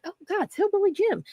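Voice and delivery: mock starstruck inner voice; starstruck gasp. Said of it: "And I was like".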